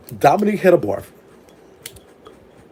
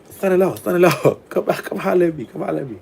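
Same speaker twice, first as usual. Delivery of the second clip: calling out; 6 semitones higher than usual. Retold or imitated. Calling out to him in library.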